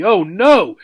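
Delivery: a sharp, loud, high gasp of panic. Acted. Hamster sees the empty food dish, gasps oh no in panic. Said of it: "she gasped".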